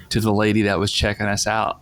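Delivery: usual speaking voice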